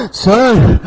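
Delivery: breathless gasped sir; panting sir. Shown as a demonstration. Students bursting into class, panting sir. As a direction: gasp out sir short of breath. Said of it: "ऐसे नहीं हाँफते".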